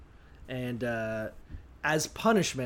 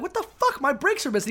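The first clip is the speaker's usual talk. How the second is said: high, startled yelp; mock shocked driver; 9 semitones higher, faster than usual. Driver who crashed finding his brakes gone, high startled yelp. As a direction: squeal in disbelief at the missing brakes.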